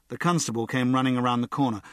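plain reading voice

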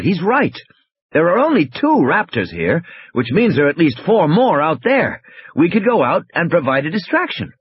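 animated scientist making his case; Wu reasoning urgently. Acted. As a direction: lay out the plan with urgency.

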